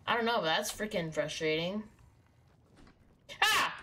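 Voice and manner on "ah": sudden high loud yelp of fright; startled yelp. Real, not acted. Startled by a monster in the game, high yelp. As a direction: jump and yelp.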